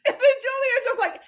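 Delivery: usual speaking voice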